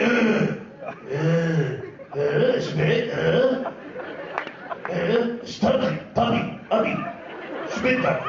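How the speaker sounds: grunting and spitting noises; mock manly grunts. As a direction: grunt like a gruff man.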